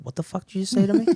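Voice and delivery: high, fast, stunned outburst; shocked disbelief. Retold or imitated. Husband stunned by wife's words, high shocked blurt. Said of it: "I said".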